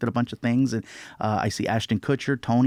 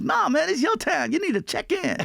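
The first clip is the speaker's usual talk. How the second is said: imitating Chris Rock. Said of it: "He's like".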